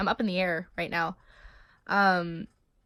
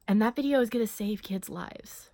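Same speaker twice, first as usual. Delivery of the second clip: whisper; 3 dB quieter than usual. Real, not acted. Earnest aside about the video.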